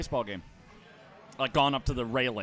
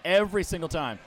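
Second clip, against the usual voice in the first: ordinary talk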